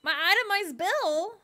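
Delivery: loud, high-pitched incredulous question; shocked disbelief. Real, not acted. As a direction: blurt it out high and loud, aghast. Shocked at receiving a bill.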